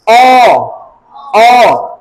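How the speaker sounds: ओ held as a long open vowel; teacher demonstrating a long ओ. Shown as a demonstration. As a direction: stretch ओ out for learners. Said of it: "long sound ओ का sound".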